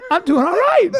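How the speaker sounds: repeated through real laughter; laughing. Real, not acted. To the guys, laughing through the repeat. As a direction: say it again while cracking up. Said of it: "repeat through laughter".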